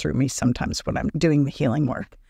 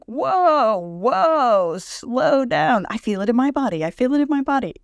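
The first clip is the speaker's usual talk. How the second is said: higher and louder, whoa whoa panic; mock alarmed; 8 semitones higher, 3 dB louder than usual. Imitating yourself catching a friend's panic, alarmed. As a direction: act out feeling the fear take over. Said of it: "And it's like".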